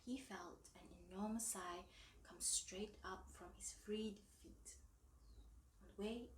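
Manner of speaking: ordinary delivery, nothing marked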